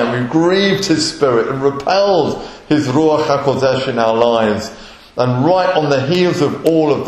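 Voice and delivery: loud, driving preacher's voice; preaching at full force. Acted. Preacher urging the congregation about repentance, loud and fervent. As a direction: press the point home to the congregation.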